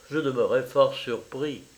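plain reading voice

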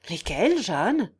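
eager question. Acted. Godfather pressing the girl for her reasons, eager. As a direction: ask promptly, voice rising at the end. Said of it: "demanda vivement Guillaume".

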